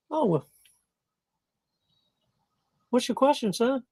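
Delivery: high-pitched mother voice; put-on high voice of an attentive mom; mommy answering her son. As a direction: play the mom, pitched well up. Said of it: "She listens".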